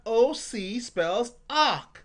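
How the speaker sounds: slow, deliberate, each letter separate; teacher drilling ock slowly; slow phonics drill. Shown as a demonstration. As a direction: spell it out slowly for kids. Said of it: "Turn my ball around".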